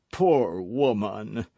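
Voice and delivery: slow, drawn-out grieving cry; broken lament. Acted. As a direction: let the grief break through slowly. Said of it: "murmured Athos in a broken voice".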